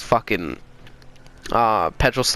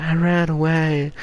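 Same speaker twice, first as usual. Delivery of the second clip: slow, timid little whine; imitated scared kid; 3 semitones higher, slower than usual. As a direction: draw it out like a frightened kid. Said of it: "I'm fucking scared".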